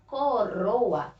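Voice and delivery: "coroa" with the R flicked on the tongue; careful demo of "coroa" with a tongue-tip R; trilled R. Shown as a demonstration. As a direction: pronounce "coroa" deliberately, letting the single R vibrate. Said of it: "o R, ele é tremido".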